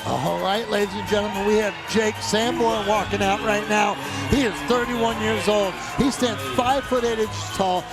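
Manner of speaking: hype walkout intro. Real, not acted. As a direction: pump up the crowd. Commentator introduces fighter walkout.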